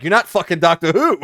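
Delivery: loud exasperated shout; angry outburst. Retold or imitated. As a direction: yell it in frustration. Exasperated retort in argument.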